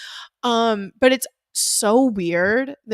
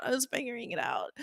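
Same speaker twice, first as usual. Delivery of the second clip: fast, much softer mutter; muttered aside; 14 dB quieter, faster than usual. Real, not acted. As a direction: toss it off under your breath. Repeated line, muttered aside.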